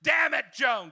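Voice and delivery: high, loud furious diva yell; Bette Davis yell. Retold or imitated. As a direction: snap it at Joan like Bette Davis. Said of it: "'cause she's Bette Davis".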